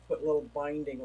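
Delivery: ordinary talk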